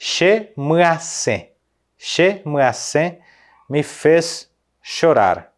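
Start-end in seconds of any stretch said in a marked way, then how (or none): 0.0-3.2 "Che me asen" said slowly and clearly, syllable by syllable; careful slow demo of "Che me asen"; slow teaching pronunciation. Shown as a demonstration. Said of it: "como que eu falaria me fez chorar?".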